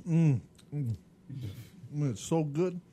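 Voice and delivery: talking through a mouthful; words round a bite of pizza; mouth full. Real, not acted. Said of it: "Talking with our mouth full".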